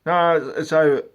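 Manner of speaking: loud high insisting; mock customer digging in; hammed-up stubborn homeowner; insistent customer. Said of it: "customer insisting".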